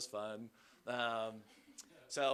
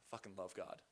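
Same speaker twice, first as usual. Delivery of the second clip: sighed under breath; 4 semitones lower, 10 dB quieter than usual. Real, not acted. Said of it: "Sorry, there it is".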